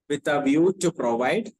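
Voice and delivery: slow, deliberate phrase-by-phrase dictation. Acted. Teacher dictating notes to the class, slow and repeated. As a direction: dictate slowly and repeat each phrase for the note-takers.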